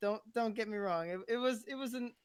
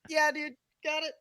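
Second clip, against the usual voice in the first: high, raised-voice acknowledgment; re-enacted pitched-up quick agreement; hasty retreat reply; 9 semitones higher, 7 dB louder than usual. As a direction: act out backing off after being yelled at. Acting hasty reply to the scream.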